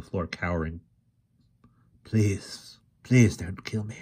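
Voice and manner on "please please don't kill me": frightened whispered plea; quavering plea. A narrator's reading. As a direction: beg for your life, trembling. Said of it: "he said in a quavering voice".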